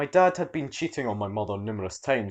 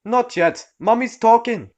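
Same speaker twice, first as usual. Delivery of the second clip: curt shrill mum; 12 semitones higher, 9 dB louder, slower than usual. Acted.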